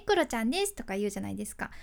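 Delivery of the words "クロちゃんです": high-pitched comedian catchphrase impression; Kuro-chan. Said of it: "まあ、高い声でさね". (高い声の芸人のモノマネ)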